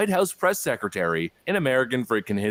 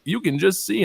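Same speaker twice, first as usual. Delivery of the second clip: ordinary talk, nothing marked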